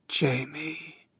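put-on high, soft old crone whisper; old woman whispering. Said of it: "an old woman's voice whispers".

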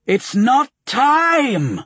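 high, slow, raging witch shriek; a mad sorceress screaming in a shrill, foreign voice; playing both parts. A narrator's reading. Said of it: "she raged".

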